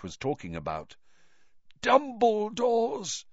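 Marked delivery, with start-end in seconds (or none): 1.8-3.2 high, slow, strained word; drawn-out gasp; laboured effort. A narrator's reading. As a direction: squeeze the name out syllable by syllable. Stricken man struggling to speak.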